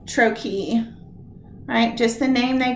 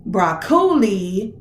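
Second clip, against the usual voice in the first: "broccoli" stretched slowly as bro-COH-li; stress on middle syllable; slower than usual. Shown as a demonstration. Teacher testing where stress goes, "broccoli" slowly stressed on CO. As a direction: wrongly lean on the middle of "broccoli", CO. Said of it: "where does the stress go?".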